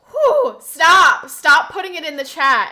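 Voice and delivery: loud, high, emphatic order; exasperated shouting. Real, not acted. Streamer scolding chat over yawns, exasperated shouting.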